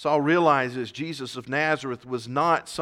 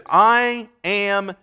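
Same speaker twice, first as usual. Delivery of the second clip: very slow, measured pronouncement; the voice from heaven answering Saul; voice of Jesus; 4 semitones higher, 4 dB louder, much slower than usual. Acted. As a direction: let each word fall slowly and heavily. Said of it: "and the voice said".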